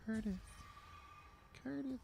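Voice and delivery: soft, high-pitched mom calling a sleeper; imitated mom's voice. Whispering to a sleeping roommate, high mock mom voice. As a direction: croon the name high and softly, like his mom. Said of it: "I'd make his mom's voice".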